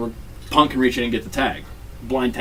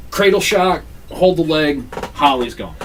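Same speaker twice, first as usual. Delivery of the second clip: ordinary talk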